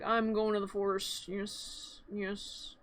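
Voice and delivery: low, soft mock resolve; muttering to himself. Jack heading to the forest, muttering low. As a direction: trail off into barely voiced little yeses.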